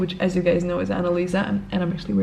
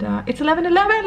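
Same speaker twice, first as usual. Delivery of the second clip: excited; 9 semitones higher, much faster than usual. Real, not acted. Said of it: "let's make a wish".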